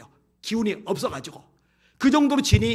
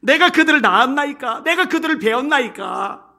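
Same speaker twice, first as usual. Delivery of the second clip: raised, indignant rhetorical questions; protesting; 6 semitones higher, 6 dB louder than usual. Acted. Preacher reading Moses' complaint to God, indignant protest. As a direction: challenge God with each question. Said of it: "이렇게 따지는 거예요".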